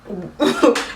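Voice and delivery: short disbelieving laugh; re-enacted laugh. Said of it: "I laugh".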